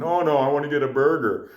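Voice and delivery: light impression of a befuddled stranger; lightly voiced frail old man. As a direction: mildly imitate the drunk stranger. Voicing the frail drunk man.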